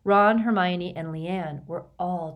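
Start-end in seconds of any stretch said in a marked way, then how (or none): none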